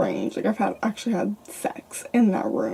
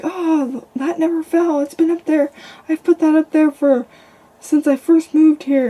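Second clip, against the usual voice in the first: high, louder retold alarm; freaking out; 7 semitones higher, 6 dB louder than usual. Boyfriend insisting the thing never fell, imitated freaking out. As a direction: imitate someone thrown into a panic. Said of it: "freaking out".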